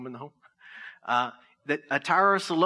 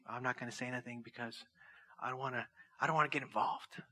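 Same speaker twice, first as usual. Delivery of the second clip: mock timid bystander, half under the breath; 4 semitones lower, 10 dB quieter, faster than usual. A believer mimicking someone dodging drama, murmuring softly. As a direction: shrink back, voicing someone avoiding conflict. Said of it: "like, say".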